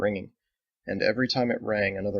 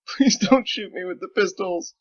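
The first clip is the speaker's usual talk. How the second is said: higher, louder tearful plea; blubbering; 10 semitones higher, 5 dB louder than usual. Acted. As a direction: beg through tears. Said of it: "Sarah blubbered".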